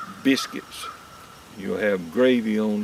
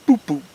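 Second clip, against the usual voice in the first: boop boop, loud and higher, like a truck bump; imitated thump; 6 semitones higher, 11 dB louder than usual. Telling listeners how the truck hit the squirrel, loud mock bump. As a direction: mimic the truck going over something. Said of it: "I heard my truck say".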